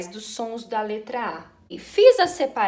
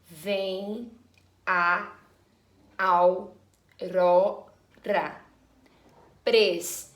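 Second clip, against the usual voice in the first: slow, each word set apart by long pauses; split syllable by syllable; 4 semitones lower, much slower than usual. Shown as a demonstration. Teacher separating a verse for her pupils, slow and broken up. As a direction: count the verse out one piece at a time, slowly. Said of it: "Vamos separar normalmente primeiro".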